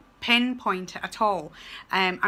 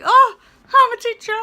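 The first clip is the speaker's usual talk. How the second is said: much higher and louder, bursting with pride; thrilled; 13 semitones higher, 13 dB louder than usual. Retold or imitated. Student teacher hearing pupils say her name, thrilled squeal. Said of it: "you're like".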